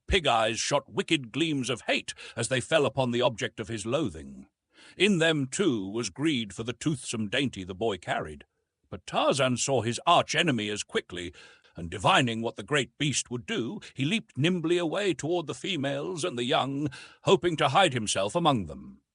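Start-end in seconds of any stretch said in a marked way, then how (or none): none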